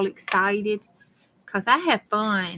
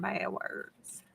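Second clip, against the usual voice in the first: hushed whisper; 13 dB quieter than usual. Real, not acted. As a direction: drop to a whisper. Avoiding saying taboo words aloud.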